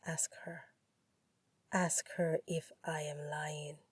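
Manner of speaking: soft, low, goading rival; poised low taunt; low drawl. A narrator's reading. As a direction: dare him quietly, unhurried. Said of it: "said in that low drawl".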